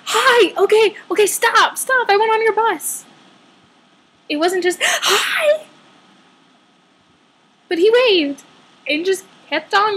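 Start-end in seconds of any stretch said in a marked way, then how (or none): 0.1-3.1 high, loud urgent calling; frantic waving plea. Retold or imitated. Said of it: "I was saying". 4.8-5.7 high chirpy hello; mock cheery hi. Mocks a cheery greeting.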